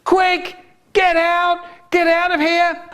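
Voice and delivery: very high, squeaky interjection; funny urgent voice. Acted. Funny voice returns, interrupting Eileen.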